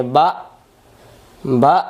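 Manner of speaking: বা with an abrupt glottal stop; hamza push on বা. Shown as a demonstration. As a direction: punch বা and cut it off. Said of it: "উচ্চারণ এমন হবে". (বা-তে হামজার ধাক্কা)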